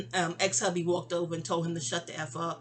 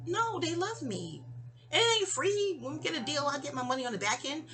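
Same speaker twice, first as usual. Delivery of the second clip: higher-pitched insistent rebuttal; acted protest; 6 semitones higher than usual. Husband insisting the rappers love him, mock protesting. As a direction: retell an insistent correction, pitched up.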